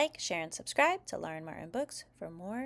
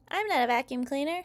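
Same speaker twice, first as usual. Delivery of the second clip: put-on high, indignant kid protest; child's shout; 6 semitones higher, 4 dB louder, faster than usual. A narrator's reading. Child grabbed by Daddy Robot, high protesting shout. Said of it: "shouts Bluey".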